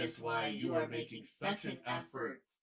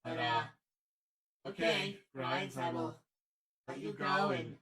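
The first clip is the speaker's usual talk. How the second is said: ordinary talk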